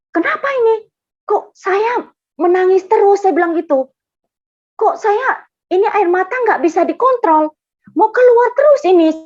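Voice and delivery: imitated tearful wail, high and loud; mock crying. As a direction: retell it as a bewildered, uncontrollable sob. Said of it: "sampai saya gini nangisnya".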